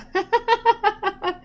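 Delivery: high bright laugh; short amused laugh. Real, not acted. Grandma laughing, she laughs.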